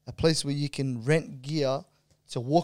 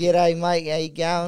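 Aussie accent; 3 semitones higher, 6 dB louder, slower than usual. Shown as a demonstration. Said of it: "I'll turn on my Aussie accent".

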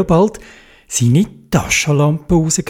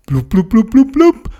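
bubbling sounds; 5 semitones higher than usual. A narrator's reading. Bubbles rising underwater.

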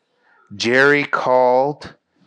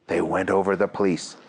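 plain reading voice

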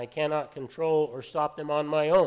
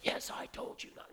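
hissed angry whisper, no voice at all; 12 dB quieter than usual. Retold or imitated. Mimicking a calmed-down arguer, angry whisper. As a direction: whisper it, still annoyed. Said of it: "It might be an angry whisper".

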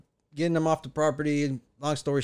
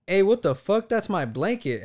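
retold girlfriend's outburst, higher and louder at the start; indignant outburst; 7 semitones higher, 4 dB louder than usual. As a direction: imitate an indignant girlfriend calling someone out. Girlfriend spots her blanket taken.

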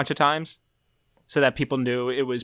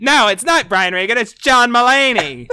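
Mulaney impression; 8 semitones higher, 8 dB louder than usual. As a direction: play the indignant stand-up, laughing. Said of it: "that's my Mulaney impression".

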